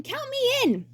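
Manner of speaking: squeaky, gushing excitement; high thrilled kid voice. Acted. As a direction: bounce with glee, way up high. Roy eager.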